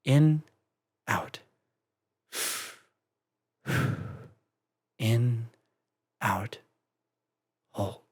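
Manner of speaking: soft, fading breath counting; steady yourself, breath by breath; calming breaths. Acted. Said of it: "focusing on her breathing".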